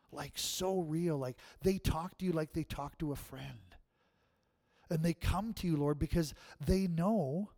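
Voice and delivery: prayer voice building in intensity; fervent prayer. Acted. Pastor praying for the congregation, fervent. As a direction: pray earnestly over the church.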